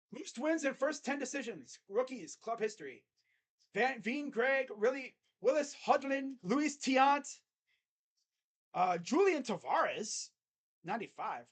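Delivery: announcer-style stat call; excited stat reading. Real, not acted. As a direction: call out the names with hype. Reading out a stats graphic.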